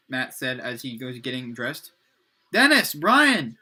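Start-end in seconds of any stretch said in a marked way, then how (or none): 2.5-3.6 loud, higher shout to roommates; calling out. A narrator's reading. Matt calls to his new roommates, shouting. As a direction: call their names out, drawn out and loud. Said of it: "he shouted to his new roommates".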